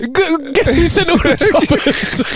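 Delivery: God's voice. Acted. God teasing the man on the roof, high and slow. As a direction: draw it out like a smug deity.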